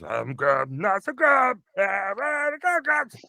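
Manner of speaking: high, shouted imitation of a show intro; Game Grumps mimicry. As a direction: mimic the intro chant, loud and shrieked high. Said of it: "he was always watching their stuff backstage".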